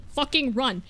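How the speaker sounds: ordinary talk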